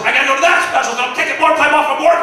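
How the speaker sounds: normal talking voice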